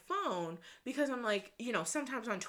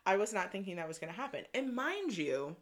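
ordinary talk

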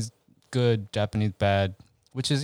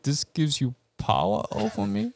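Bane quote; 4 semitones higher than usual. Retold or imitated. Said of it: "Bane quote".

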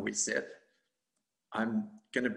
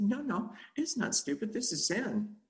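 defensive protest; 9 semitones higher than usual. Retold or imitated. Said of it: "I said".

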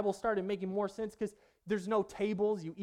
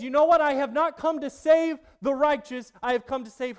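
proclaiming loudly; 8 semitones higher, 12 dB louder than usual. Acted. Preacher voicing Jesus' proclamation.

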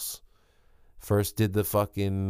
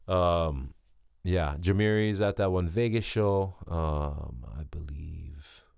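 normal talking voice

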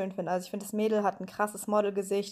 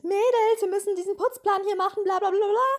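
high, loud nagging mock voice; mocking Solin bossing; 11 semitones higher, 5 dB louder than usual. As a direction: parody the bossy housemate's chore speech. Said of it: "wieder gleich anfängt mit". (nachgeäffte nervige Putzplan-Ansage, hoch und laut)

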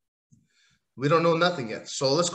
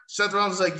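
ordinary talk

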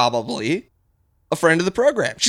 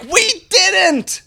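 high, slow, wailing blame; whiny accusing voice; slower than usual. Acted. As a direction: wail the blame slowly and high. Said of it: "And we're like".

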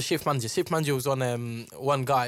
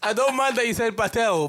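laughing; 7 semitones higher, 5 dB louder than usual. Real, not acted. Still laughing.

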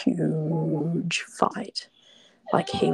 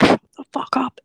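a whispered mock yell, pitched higher; imitating his snap; 7 semitones higher, 7 dB louder than usual. Retelling her ex shouting at her in the hotel, hushed mock yell. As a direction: spit it out like his angry snap, still hushed. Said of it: "he's like".

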